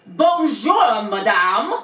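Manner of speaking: put-on loud, French-accented "Bonjour, madame"; a pointed French clerk's bonjour; French greeting.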